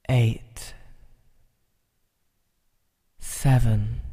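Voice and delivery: slow, hushed count from ten down; slow soothing countdown. Acted. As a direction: count them down gently into calm. Counting listener down into relaxation.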